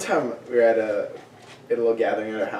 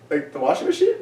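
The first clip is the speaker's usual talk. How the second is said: very high, rising puzzled question; confused question. Retold or imitated. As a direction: squeak it out, totally lost.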